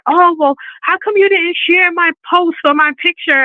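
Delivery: high-pitched imitation of someone put out; mock complaint. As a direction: act out a whiny reproach to a follower.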